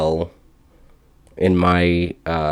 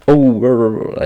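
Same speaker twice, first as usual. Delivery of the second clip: raised, loud mock-British gibberish; bad British accent; 5 semitones higher, 5 dB louder than usual. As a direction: fumble a hammed-up British accent. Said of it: "I can't do a, a random British accent".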